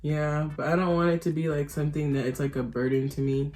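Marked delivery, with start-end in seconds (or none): none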